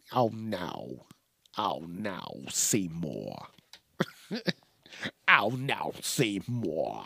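low, soft "oh no, Seymour" voice; deep, quiet mock-dismay character voice. Quoting a character voice.

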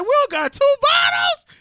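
very high, slow, loud holler; club boasting shout. Retold or imitated. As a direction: shout it across a packed club.

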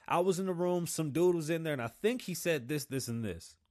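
sarcastic mimicry of a hearsay witness; mocking vague witness. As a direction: parody a witness who only guessed. Mock vague witness account.

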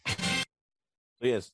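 usual speaking voice

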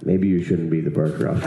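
low-pitched imitation of the boss; lightly voiced boss stepping in; disapproving boss. Boss pulling an elf off barker duty, low disapproving voice. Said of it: "The guy was like".

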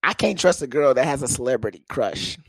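usual speaking voice

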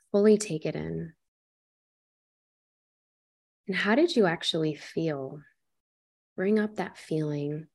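calm guide talking the listener through a painful memory; guided meditation. Acted. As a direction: ease the listener into their breath and body.

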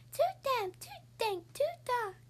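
tiny high squeaky mouse voice. Acted. Mouse complaining about the damp cave, high squeak. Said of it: "Mouse squeaks".

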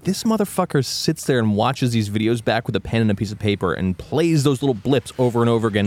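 usual speaking voice

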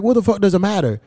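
ordinary talk

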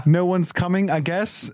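high-pitched mock uncertainty; sheepish guessing. Hapless lookout reporting to Robin, unsure. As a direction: trail up into a doubtful question.

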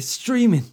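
streaming said loud and high, mocking the word; mock emphatic streaming. Shown as a demonstration. Comic mocking the buzzword streaming, said loud and high. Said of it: "like when the word seasons came in".

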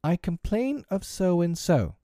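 put-on high, slow, formal child's complaint; prim little formal grievance, pitched high; polite complaint. A narrator's reading. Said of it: "raising the right arm politely and saying".

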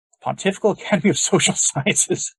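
can't keep a straight face; laughing. Real, not acted. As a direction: laugh breaking in mid-phrase. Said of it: "I can't say that without laughing".

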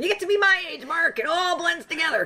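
put-on high, creaky old woman voice; old lady voice. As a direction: play a doddering old lady. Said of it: "your acting comes out with your old lady voice".

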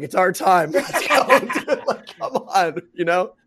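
high, loud hyped-up call to the guys; rally your buddies with a laugh breaking in; pumped-up, laughing battle cry. Retold or imitated.

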